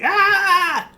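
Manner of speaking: high, held cartoon scream; imitated shriek of horror; mock horror scream. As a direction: scream in put-on terror. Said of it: "Dave gets this look of horror".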